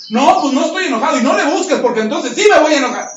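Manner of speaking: loud, fast, irritated protest with a laugh; heated, rushed denial breaking into a laugh; angry denial. Retold or imitated. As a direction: snap back that you're not angry. Man angrily denying he's angry.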